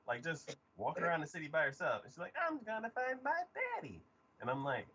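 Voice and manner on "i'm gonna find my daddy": high-pitched child imitation; little girl voice. Little girl wandering the city alone, high child voice. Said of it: "you see this girl".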